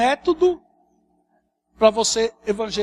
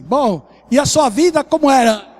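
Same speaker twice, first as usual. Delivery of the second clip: imitação enfática de apresentador de TV, voz alta; apresentador evangélico; 5 semitones higher, 10 dB louder than usual. Retold or imitated. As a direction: puxe o testemunho em volume alto, imitando o apresentador. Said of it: "o camarada dizia assim". (apresentador evangélico em voz alta e enfática)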